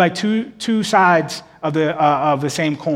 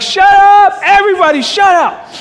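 a father's yell, much higher and louder; blowing up; 9 dB louder than usual. Retold or imitated. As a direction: snap at the noisy kids at full volume. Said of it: "so I just blew up".

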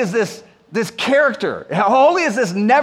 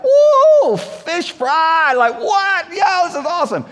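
high, loud whooping fisherman's joy; excited whoop; 9 semitones higher, 4 dB louder, slower than usual. Retold or imitated. Peter whooping at the net full of fish, thrilled. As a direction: whoop it up over the huge catch. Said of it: "Peter's reaction should be like".

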